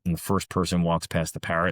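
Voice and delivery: normal talking voice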